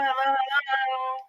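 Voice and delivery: usual speaking voice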